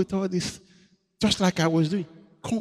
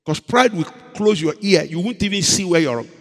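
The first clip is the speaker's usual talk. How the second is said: ordinary delivery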